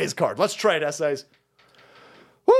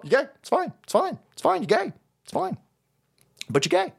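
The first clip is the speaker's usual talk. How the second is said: high mocking it's fine; teasing singsong; 7 semitones higher than usual. Real, not acted. Host teasing viewers, high and mocking. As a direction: tease them, light and high.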